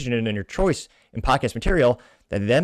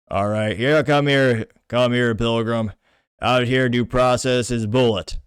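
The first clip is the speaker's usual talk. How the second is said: a lightly done, slow John Wayne drawl; mild slow John Wayne accent, not done well; 3 dB louder, slower than usual. Retold or imitated. Podcaster imitates John Wayne for listeners, slow and mild. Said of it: "Can I do a John Wayne accent? I can, but not well".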